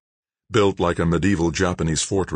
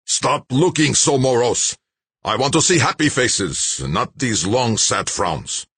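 put-on rasping old man; 8 semitones higher, 4 dB louder than usual. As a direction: bark it like a dying old boss. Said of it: "The old man's rasping voice".

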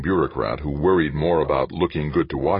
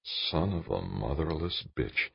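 fervent mutter; 9 dB quieter, slower than usual. A narrator's reading. Dan hears of the secret marriage, fervent mutter. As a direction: mutter it slowly under the breath. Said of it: "Dan muttered fervently".

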